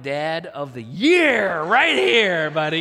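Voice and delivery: slow, loud proud boast. Retold or imitated. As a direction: crow about your win, stretched out. Proud after sugar trick.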